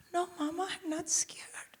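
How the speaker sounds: put-on high, whispery child voice; hushed reenactment of a tearful little girl; imitated little daughter. Voices sobbing daughter in cinema.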